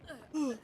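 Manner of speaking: sudden startled gasp; sharp gasp. Real, not acted. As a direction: gasp in shock. Sudden gasp watching teammate.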